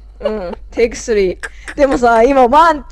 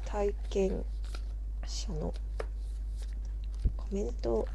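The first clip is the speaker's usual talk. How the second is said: soft whispered run-through; whispering; 14 dB quieter than usual. Shown as a demonstration. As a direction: whisper the line to yourself. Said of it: "whispered rehearsal of the line".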